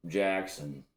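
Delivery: normal talking voice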